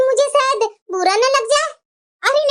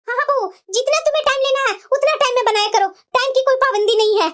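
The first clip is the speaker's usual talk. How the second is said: fast relieved reassurance; mother-in-law relieved; faster than usual. Acted. Mother-in-law quickly assuring daughter-in-law there's no time limit, fast. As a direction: rush out to reassure her.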